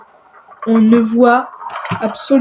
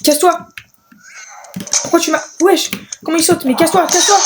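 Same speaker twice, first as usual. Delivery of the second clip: higher, urgent shoo-away; a raised, urgent casse-toi aimed at a creature; telling it off; 5 semitones higher, faster than usual. Real, not acted. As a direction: chase the screaming thing off. Shoos an attacking creature.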